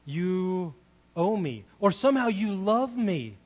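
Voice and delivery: loud, slow, emphatic claim; imitating a son insisting on what he is owed; entitled older son. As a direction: lean hard on each word. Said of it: "because he thinks".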